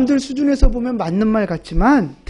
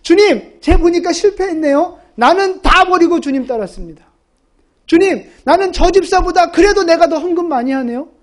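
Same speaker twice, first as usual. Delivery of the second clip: higher and louder, smug bragging to the Lord; boastful prayer; 5 semitones higher, 4 dB louder than usual. Retold or imitated. Believer boasting to the Lord like Peter, smug and loud. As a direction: preen about your own devotion. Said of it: "다 베드로 같은 마음이 있어요".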